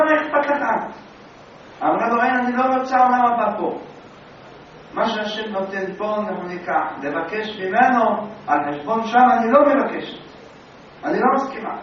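loud, high insistence; wife arguing, loud and high; wife's firm refusal. Acted. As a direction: refuse him with force. Wife refuses, argues with husband.

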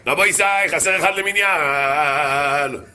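drawn-out call without real volume; mock shout. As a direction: call it out slowly, stretching the last word. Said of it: "רב, רב צעק".

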